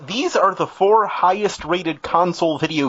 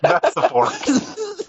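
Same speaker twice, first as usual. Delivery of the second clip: slow high silly voice; much slower than usual. Pretend. Mocking the game title.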